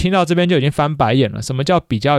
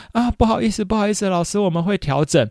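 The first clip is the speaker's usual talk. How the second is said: apologetic mother; 6 semitones higher than usual. Retold or imitated. Mother apologizes to the teacher on the phone, high and flustered. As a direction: play the mother saying sorry in a raised voice.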